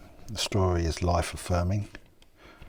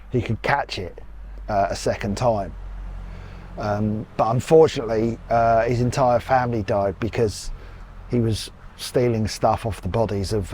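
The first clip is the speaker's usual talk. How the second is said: tour guide narration; 2 semitones higher, 5 dB louder than usual. Acted.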